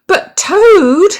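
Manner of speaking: very high, loud plea; cried out. A narrator's reading. Frog crying out as Toad goes back to bed. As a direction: cry out in protest. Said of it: "cried Frog".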